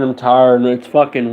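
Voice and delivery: ordinary delivery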